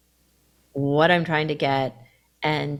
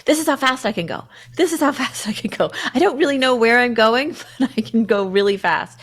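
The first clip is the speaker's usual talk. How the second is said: giddy terrier voice; 6 semitones higher than usual. Retold or imitated. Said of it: "it's like".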